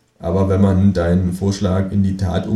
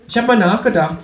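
high, cheeky kangaroo voice; 13 semitones higher, 4 dB louder than usual. Acted. Kangaroo asks narrator to shove over, high cheeky. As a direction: pitch way up for the cheeky marsupial.

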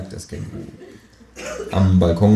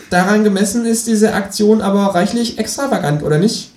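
much higher kangaroo, still laughing; 13 semitones higher, 4 dB louder than usual. Acted. Kangaroo mocks the narrator's plan, high and laughing. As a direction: tease him through the laugh. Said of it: "Känguru speaks".

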